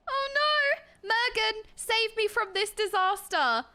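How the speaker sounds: high mock-desperate wail; playful panic. Streamer begging a game character for rescue, mock panic. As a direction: plead for rescue, hammed-up and dragging out the end.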